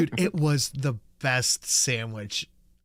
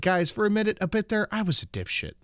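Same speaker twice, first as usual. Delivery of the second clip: higher, excited chat-message read; bright higher reading of a fan's chat; 9 semitones higher than usual. Acted. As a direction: ham up a viewer's message. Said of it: "Steve Dave said".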